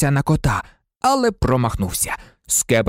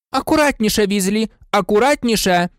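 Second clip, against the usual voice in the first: teacher's shout; 6 semitones higher than usual. A narrator's reading. Professor Sprout shouts a warning at Weasley. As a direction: call out sharply across the greenhouse. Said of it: "крикнула професорка Спраут".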